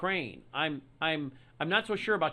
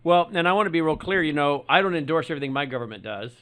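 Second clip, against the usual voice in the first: emphatic, louder; 10 dB louder than usual. Real, not acted. Host stressing he is being clear.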